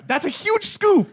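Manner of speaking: high shocked yelp. Real, not acted. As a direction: squeal at the huge scoop. Sees huge scoop.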